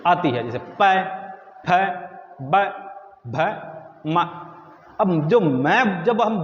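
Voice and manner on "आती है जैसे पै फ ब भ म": पै, फ, ब, भ, म each said alone with pauses; letter demo. Shown as a demonstration. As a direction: lip letters पै, फ, ब, भ, म said apart. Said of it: "दोनों होठ जब मिलते हैं".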